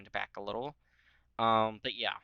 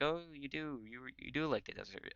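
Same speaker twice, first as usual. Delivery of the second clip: mock teasing; 4 semitones higher, 5 dB quieter than usual. Italians teasing Ottomans about desert.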